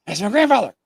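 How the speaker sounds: high, loud, startled cry of recognition; stunned realization. Retold or imitated. Grandson recognizes dead grandfather on porch, stunned cry. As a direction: blurt it out in shock.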